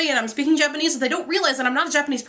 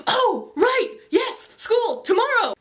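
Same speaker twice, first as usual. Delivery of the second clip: high imitation of sudden remembering; mock a supervisor only now recalling, drawn out; forgetful supervisor; 9 semitones higher, slower than usual. Supervisor finally asked about tomorrow, mock sudden realisation.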